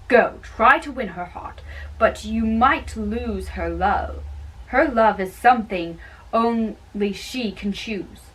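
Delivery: normal talking voice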